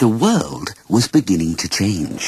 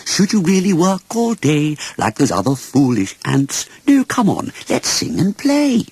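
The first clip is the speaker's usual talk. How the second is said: coaxing grasshopper; 7 semitones higher than usual. A narrator's reading. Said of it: "grasshopper coaxing young ant".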